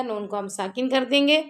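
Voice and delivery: normal talking voice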